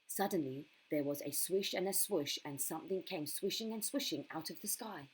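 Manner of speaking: plain reading voice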